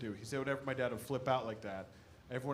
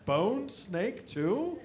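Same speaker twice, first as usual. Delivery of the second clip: incredulous; 7 semitones higher, 5 dB louder, much slower than usual. Acted. Incredulous at dad's screen name.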